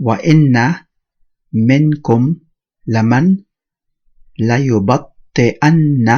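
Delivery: loud, measured Arabic recitation, word by word; Quran recitation. Acted. Teacher reciting the ayah for students, measured word-by-word recitation. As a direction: recite each word separately, with clear pauses.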